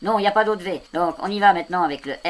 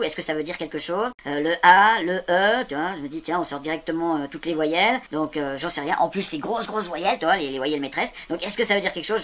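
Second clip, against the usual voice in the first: ordinary talk, nothing marked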